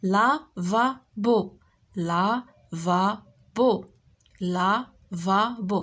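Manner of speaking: "Lavabo" very slowly as la, va, bo; syllable by syllable. Shown as a demonstration. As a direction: say "Lavabo" in three beats with gaps between. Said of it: "غنشوفوا لي سيلاب".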